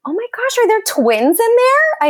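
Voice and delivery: high-pitched, tactless amazement; mock astonishment. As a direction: gasp it like a nosy onlooker. Acts tactless remark to pregnant woman.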